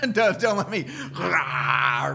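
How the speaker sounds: high, loud laughing mock shout; don't let me, rah, half-laughed growl; mock Hulk roar. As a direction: ham up a superhero rage. Joking [name] turning Hulk.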